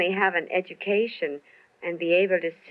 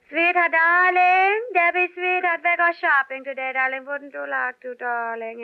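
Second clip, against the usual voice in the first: Ava Gabor impression; 11 semitones higher than usual.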